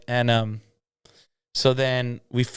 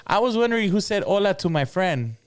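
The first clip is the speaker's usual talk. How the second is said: lady's voice; 8 semitones higher than usual. Retold or imitated. Voicing the lady in the story.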